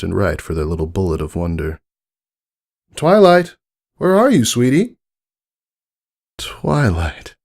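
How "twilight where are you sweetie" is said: put-on high, loud parent calling a child. Parent searching the toy shop, calling loudly and high. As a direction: call across the shop for your daughter.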